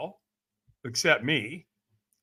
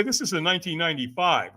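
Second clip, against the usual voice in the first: normal talking voice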